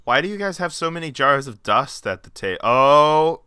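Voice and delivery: hammed-up baffled questioning; puzzled Hawkeye. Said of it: "Hawkeye being like".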